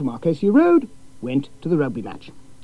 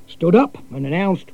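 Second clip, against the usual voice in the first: ordinary delivery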